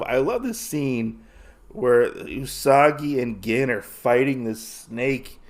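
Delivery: ordinary talk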